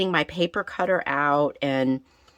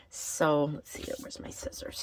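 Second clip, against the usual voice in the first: whispering; 6 dB quieter than usual. Real, not acted. Quietly looking for her scissors.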